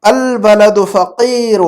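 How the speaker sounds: very slow, drawn-out Arabic recitation; model reading. Shown as a demonstration. Teacher reading a sentence for translation, very slow model reading. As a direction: read it out measured, stretching long vowels. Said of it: "جملہ پڑھتا ہوں".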